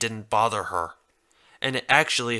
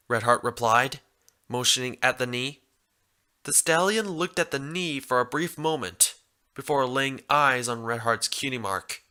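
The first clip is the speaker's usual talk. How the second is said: plain reading voice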